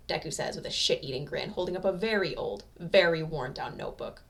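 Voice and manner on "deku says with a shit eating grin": ordinary delivery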